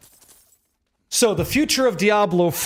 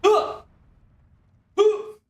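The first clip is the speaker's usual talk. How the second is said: loud gung-ho soldier; 12 semitones higher, much slower than usual. Pretend. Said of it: "I could be like this".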